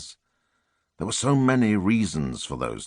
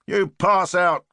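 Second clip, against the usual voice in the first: higher, louder, slow mock of "pass out"; mocking Queenie; 10 semitones higher, 4 dB louder, slower than usual. A narrator's reading. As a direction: throw "You pass out" back at him, teasing. Queenie mocks his complaint.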